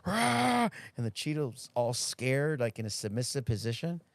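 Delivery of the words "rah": high, loud imitated bark; imitated dog bark. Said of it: "it was barking at that cheetah".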